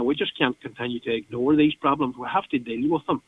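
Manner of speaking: usual speaking voice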